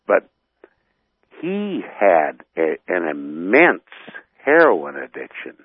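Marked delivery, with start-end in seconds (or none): none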